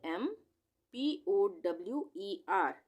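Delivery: usual speaking voice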